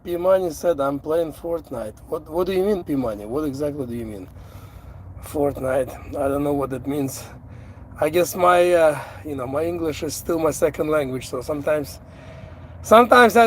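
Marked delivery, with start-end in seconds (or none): none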